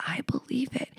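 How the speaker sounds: secret whispered confession; playful whisper to the listeners; conspiratorial whisper. Real, not acted. Confessing something cheeky.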